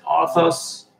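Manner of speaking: ordinary delivery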